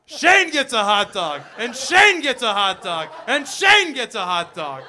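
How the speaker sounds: slow, loud prize announcement; playful big-giveaway holler; hyped giveaway host. Pretend. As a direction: draw it out and boom it like a show host.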